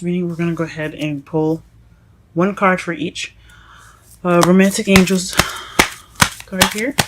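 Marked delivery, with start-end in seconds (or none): 4.2-6.9 breathless from talking so much; slightly winded, catching breath. Real, not acted. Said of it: "I'm a little bit out of breath".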